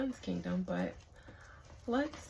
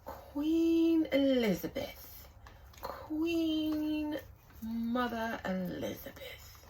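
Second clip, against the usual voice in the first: grand, slow mock British accent, pitched high and loud; 5 semitones higher, 3 dB louder, slower than usual. Vlogger hamming up the queen's titles in a mock British accent. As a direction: announce her titles like a pompous British herald. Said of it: "stop using that accent".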